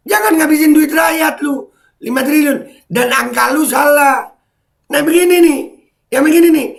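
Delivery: high, strained furious yelling; ranting at the screen, shouting high; angry shouting. Real, not acted. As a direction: yell it out, fed up and furious. Ranting furious at stadium critics.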